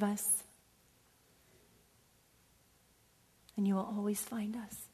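closing congregational prayer. Acted. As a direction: pray quietly and intimately.

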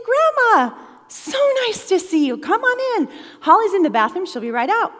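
high, loud, gushing hello; acted big welcome at the door; effusive greeting.

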